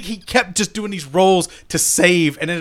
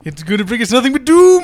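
loud, high cry of doom; hammed-up alarmed wail; dire mock warning; 9 semitones higher, 7 dB louder than usual. Player warning the party about the cursed idol, hammed-up alarm.

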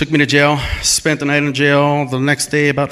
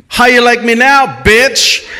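high, loud triumphant yell; retold battle cry, loud and high; 8 semitones higher, 6 dB louder, slower than usual. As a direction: scream it as you swing the barricade. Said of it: "while yelling".

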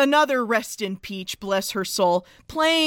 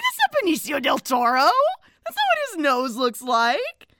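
high, incredulous squeal sliding down; mock disbelief; 3 semitones higher than usual. Reacting to seeing the actor's real nose, incredulous. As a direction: retell it in high-pitched disbelief. Retelling own shocked reaction to a photo.

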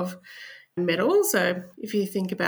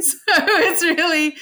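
laughing; 10 semitones higher, 8 dB louder, slower than usual. Real, not acted. Laughs about her Australian accent.

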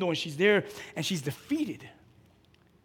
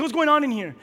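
higher, louder demand; bold challenge; 8 semitones higher, 8 dB louder than usual. Retold or imitated. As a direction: confront the room boldly. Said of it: "I went in with boldness".

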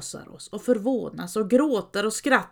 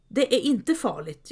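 ordinary delivery

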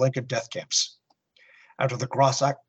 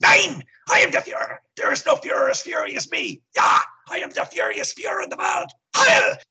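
high, shouted ranting dictator; Hitler; 5 dB louder than usual. Acted. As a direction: rant like a furious dictator. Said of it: "holding it under his nose".

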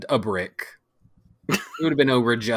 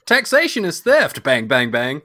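mock libertarian Harry; 3 semitones higher, 5 dB louder than usual. Mocking a libertarian Harry Potter.

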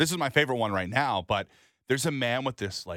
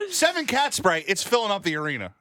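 excited; 8 semitones higher, 4 dB louder than usual. Real, not acted. Mock outrage about smell.

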